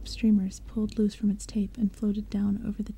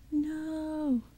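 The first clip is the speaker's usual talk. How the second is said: a high, drawn-out cry of no; anguished scream; 7 semitones higher than usual. Acted. Girl seeing her dead friend, screaming in despair. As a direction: wail it in disbelief.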